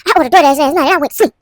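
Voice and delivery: high, fast, put-on voice for the complaining friend; retelling the friend's DoorDash complaint in a high, fast mock voice; imitating her friend. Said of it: "She was like".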